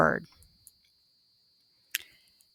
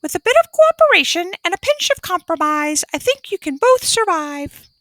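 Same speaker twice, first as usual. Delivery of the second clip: parrot voice; 10 semitones higher, faster than usual. A narrator's reading. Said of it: "the parrot thought out loud".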